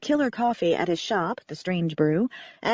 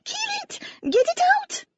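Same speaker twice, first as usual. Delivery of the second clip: put-on very high, French-accented panicked cries; terrified, high-pitched French woman; screaming in terror. A narrator's reading. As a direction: shriek it in terror. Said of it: "the petite woman screamed in terror again".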